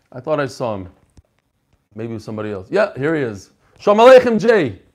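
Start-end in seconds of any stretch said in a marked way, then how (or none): 3.8-4.8 big, loud, high welcome; loud greeting. Real, not acted. Greeting a new caller on Zoom, loud and cheerful. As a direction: call out the greeting warmly.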